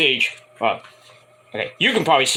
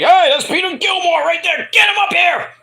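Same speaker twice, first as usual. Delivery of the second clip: mock shout, much higher and louder; excited stage shout pointing someone out; calling out to the crowd; 3 dB louder than usual. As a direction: yell it out like a singer spotting a fan on stage. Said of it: "It's like".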